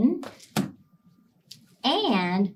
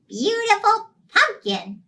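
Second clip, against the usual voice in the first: ordinary delivery, nothing marked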